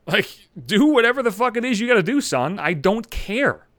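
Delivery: contemptuous fuck-off; imagined angry retort. Retold or imitated. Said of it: "I wanted to say right there".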